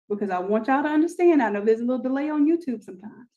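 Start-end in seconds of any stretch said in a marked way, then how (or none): none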